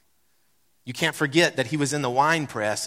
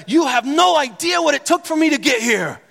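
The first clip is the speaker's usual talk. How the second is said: exasperated retort; 10 semitones higher, 6 dB louder than usual. Retold or imitated. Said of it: "what I wanna say is".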